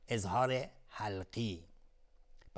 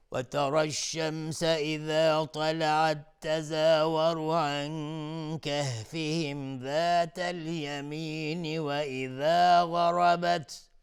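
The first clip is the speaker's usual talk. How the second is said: slow, higher, melodic tajweed chant; Quran recitation; 6 semitones higher, 7 dB louder, much slower than usual. Acted. Teacher recites the verse for students, slow melodic chant. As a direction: draw out each verse like a reciter.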